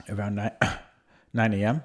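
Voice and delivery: hoarse, losing the voice; rough voice cracking through a cough. Real, not acted. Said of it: "Sorry, losing my voice already".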